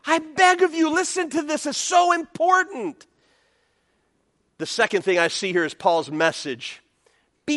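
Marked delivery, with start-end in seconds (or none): 0.0-3.1 higher, earnest begging voice; pleading Paul. Retold or imitated. Pastor voicing Paul begging the church, higher and pleading. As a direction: plead with them as Paul would. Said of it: "Paul is saying".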